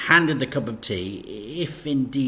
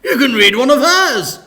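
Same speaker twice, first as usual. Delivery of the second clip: high, bright-pitched vicar, louder; bubbly church lady listing options; cheery vicar; 16 dB louder than usual. A narrator's reading. Vicar listing church performances to Dad, high and cheery.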